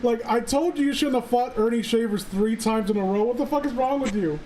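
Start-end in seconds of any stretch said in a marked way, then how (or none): none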